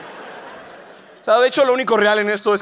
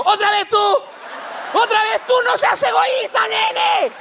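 furious scream; 12 semitones higher, slower than usual. Pretend. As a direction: shriek at her in outrage. Pope furious at the woman again.

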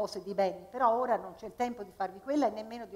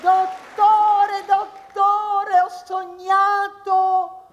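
much higher, louder singsong wail; whiny patient; 10 semitones higher, 11 dB louder, much slower than usual. Acted. Patient wailing to the doctor about a dream.

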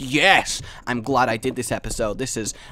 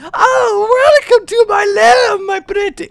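shrieking high villain welcome, much louder; Spider Queen voice; 12 dB louder, slower than usual. Acted. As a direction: taunt the intruder like a witchy queen. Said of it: "voice act the spider".

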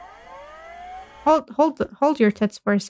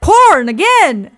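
loud, slow, higher outburst; exasperated shout; 6 semitones higher, 8 dB louder, much slower than usual. Real, not acted. Streamer finding porn again, loud slow exclamation. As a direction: drag it out in loud disbelief.

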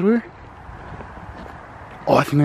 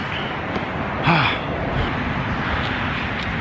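long breathy sigh after running; heavy exhausted sigh; 5 semitones higher than usual. Real, not acted. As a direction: let out a worn-out sigh. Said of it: "I'm running out of breath".

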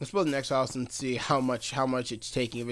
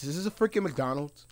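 normal talking voice

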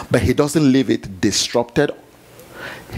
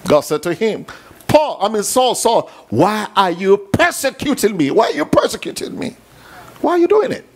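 fiery preaching; 8 semitones higher, faster than usual. Acted. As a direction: preach it at full force to the crowd. Preaching at full force.

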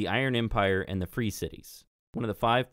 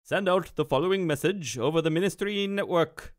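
wizard Mun; 8 semitones higher than usual. Acted. Wizard orders his young apprentice, commanding and projected. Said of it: "and he says".

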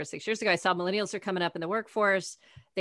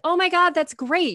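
much higher and louder, gushing; cheering a friend who might move to Oregon; thrilled outburst; 10 semitones higher, 7 dB louder than usual. Retold or imitated. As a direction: explode with delight at a friend's news. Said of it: "I was like".

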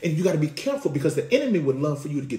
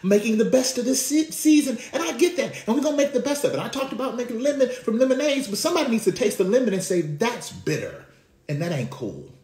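preaching at full force; 10 semitones higher than usual. Acted. Preacher to congregation, rising to a full-force cry. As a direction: lift the congregation, preaching hard.

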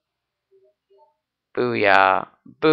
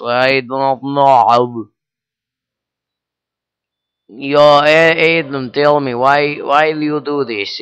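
put-on Russian-accented Vlad; 2 semitones higher, 4 dB louder than usual. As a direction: complain in a hammed-up Russian accent. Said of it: "you know what, Vlad?".

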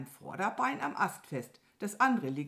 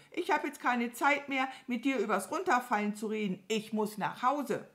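high, hurried crow voice; high-pitched, impatient bird; 7 semitones higher, 3 dB louder than usual. Acted. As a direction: play a busy crow brushing someone off.

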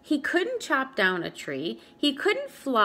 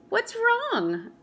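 worried mother; 11 semitones higher than usual. Acted.

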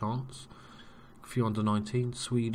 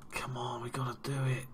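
softer whispered come on; tense whisper; 3 semitones higher, 7 dB quieter than usual. Real, not acted. Watching a last chance at goal, tense whisper. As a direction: hold your breath and whisper it.

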